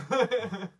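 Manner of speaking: big, high burst of laughter, cracking up; loud burst of laughter. Real, not acted. Friend cracks up at the uni jab, laughing loudly.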